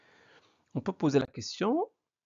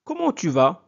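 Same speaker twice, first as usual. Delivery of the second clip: louder model question, rising on "vas"; questioning intonation; 3 semitones higher, 8 dB louder than usual. Shown as a demonstration. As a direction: project it, lifting the end on "vas". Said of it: "Avec intonation".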